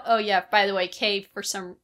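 ordinary talk

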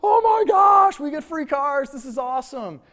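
high, loud shriek of joy fading back down; excited audience scream. Retold or imitated. Preacher imitating Oprah's audience winning cars, a delighted scream that fades. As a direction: squeal like a thrilled studio audience, then settle. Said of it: "everyone's like".

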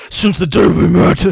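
loud, over-the-top dramatic line; dramatic guy voice. Retold or imitated. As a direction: belt it like a cheesy love song. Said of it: "the guy voice says".

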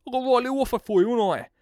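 high, fast cheeky trader patter; Del Boy impression. Host selling a lawnmower deal as Del Boy. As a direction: rattle off the offer like a market spiv. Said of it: "I feel like Del Boy when I do this".

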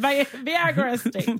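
high, slow, mock-mangled "Viagra Estate"; hammed-up replay of her own garbled "Viagra"; mock mishearing. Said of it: "I sounded like I said".

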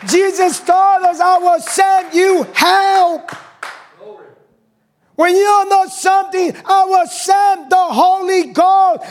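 high, loud, slow preacher's shout; sustained pulpit shouting, stretching the key words; fiery preaching. Acted. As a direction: preach it out at full force to the church. Preaching at full force.

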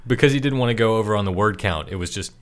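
normal talking voice

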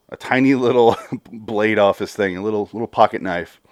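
ordinary talk, nothing marked

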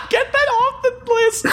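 high squealing laugh through words. Real, not acted. Laughing protest at suggestion.

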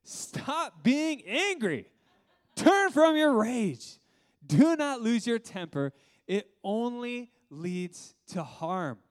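slow, loud, high, drawn out; mock bellowed verse. Shown as a demonstration. Pastor reading the anger verse aloud to the church, slow hammed-up shouting. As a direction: boom the verse out, hamming up the anger. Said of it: "Look at this verse".

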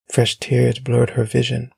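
ordinary delivery

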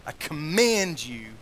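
loud, slow, weighty order; commanding. Acted. Preacher spelling out what adjure means, loud slow command. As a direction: lean hard on each word of the command. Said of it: "it literally means, "I charge you, I command you".

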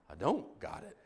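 soft, deadpan comic letdown; deflated admission. Retold or imitated. Pastor acting out Chunk after it falls, deflated admission. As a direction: let it drop quietly after the big yelling.

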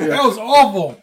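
slow, drawn out in disgust. Real, not acted. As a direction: recoil from a horrible taste. Just chewed durian piece.

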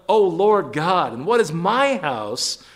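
stretched, ringing reading of a prayer; emphatic scripture reading. Acted. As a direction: proclaim David's prayer to the congregation. Reads scripture aloud with emphasis.

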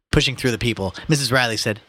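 usual speaking voice

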